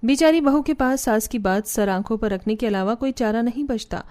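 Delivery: usual speaking voice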